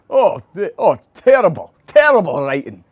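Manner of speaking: high, loud Scottish pundit's disgust; Alan Hansen impression. Pundit slating the columns, high and loud. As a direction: bellow it like an appalled pundit. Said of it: "he'd be".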